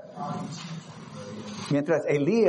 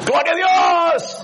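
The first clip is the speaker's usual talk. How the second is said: mock shout, much higher and louder, slow; grito de júbilo; 6 dB louder, slower than usual. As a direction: proclaim it like a thrilled crowd. La gente celebra a Dios. (grito exaltado y agudo, lento)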